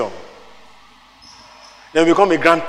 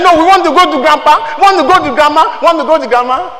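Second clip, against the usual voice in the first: put-on high, insistent children begging; pleading children; 8 semitones higher, 6 dB louder than usual. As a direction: plead like kids who want grandma. Children begging, voiced by preacher.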